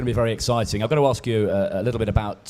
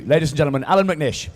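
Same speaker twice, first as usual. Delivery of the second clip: raised, higher-pitched presenter's call; announcer sign-off; 4 semitones higher, 4 dB louder than usual. Real, not acted. Host presenting the driver to the audience, announcer sign-off. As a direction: present the guest to the crowd, voice lifted.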